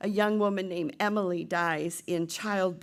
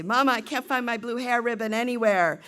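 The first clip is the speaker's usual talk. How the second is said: put-on higher, louder child calling out; twelve-year-old girl; 5 semitones higher, 7 dB louder than usual. Girl hunting her hair ribbon calls mother, childlike complaint. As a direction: call to Mama like a fretting child. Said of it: "her twelve-year-old self say".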